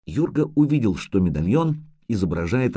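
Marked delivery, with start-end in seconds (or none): none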